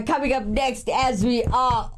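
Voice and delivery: usual speaking voice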